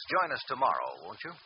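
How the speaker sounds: normal talking voice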